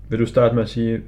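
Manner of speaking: ordinary talk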